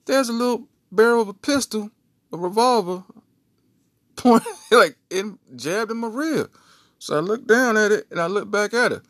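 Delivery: can't believe the gun; excited retelling. Real, not acted. Telling gun reveal.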